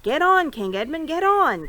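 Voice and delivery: loud urging call from above; Trumpkin calling. A narrator's reading. Trumpkin urging King Edmund from behind, loud call. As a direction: hurry him on. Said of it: "Trumpkin's voice from behind".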